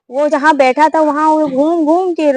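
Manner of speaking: ordinary delivery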